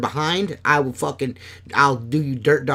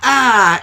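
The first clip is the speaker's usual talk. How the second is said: a sudden high, loud yelled "Ah!"; dad's loud yell; 9 semitones higher, 8 dB louder than usual. Retold or imitated. Imitating dad posing as the Kool-Aid Man, loud yell. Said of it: "And he".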